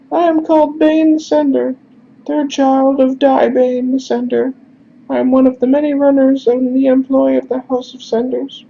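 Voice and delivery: formal falsetto introduction; high-pitched character voice. Acted. Messenger introduces himself to stranger, high-pitched voice. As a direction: introduce yourself politely, way up high. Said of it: "we're just gonna do high-pitched instead".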